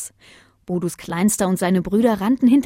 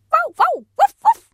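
very high, loud yappy woofs and calls; high-pitched puppy voice; little puppy calling; 5 dB louder than usual. Acted. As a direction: yap for Papa like a little pup. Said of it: "eine Stimme aus der Nähe".

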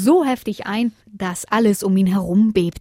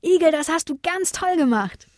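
high-pitched cheers for a hero; celebrating in a high voice; jubilant cheering; 9 semitones higher than usual. A narrator's reading. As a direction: cheer him on in a high little voice. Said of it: "jubelten dem Igel zu".

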